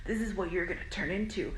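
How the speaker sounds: hushed, whispery demonic threat; eerie whispered possession act; possessed voice. Retold or imitated. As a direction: imitate someone possessed, mostly under the breath. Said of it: "talking in, like, a possessed voice".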